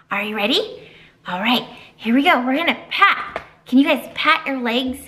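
usual speaking voice

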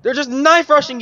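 high, loud rallying shout; urgent shout. Real, not acted. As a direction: yell at the team to push. Urging team into fight.

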